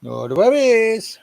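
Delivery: stretched-out, high goofy "No worries"; slow silly voice. Acted. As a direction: drag it out in a daft high voice. Echoing the phrase in a silly voice.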